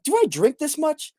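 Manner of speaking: mock self-questioning, high and thin. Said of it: "I was like".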